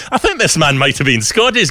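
high, loud, hammed-up Scottish accent; terrible Scottish accent. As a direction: play up a deliberately bad Scottish accent. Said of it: "so will this terrible accent return".

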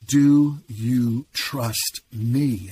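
very slow, weighty repeat of the question; loud voice of God. Retold or imitated. God pressing a broken man for an answer, drawn out and emphatic. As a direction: stretch out every word as God's insistent voice. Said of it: "And then I heard again".

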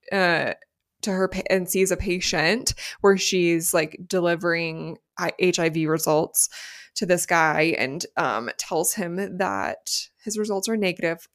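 normal talking voice

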